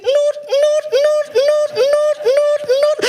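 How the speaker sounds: high, loud electronic beeps; meter beeping. Acted. Wife imitates the electricity meter beeping, high and loud. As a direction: beep like a power token meter.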